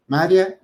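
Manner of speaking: "María" said as MÁ-ria, two syllables; stress shifted to first syllable. Shown as a demonstration. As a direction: stress the first A and glide the "ia" together. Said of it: "no lo viéramos con el acento".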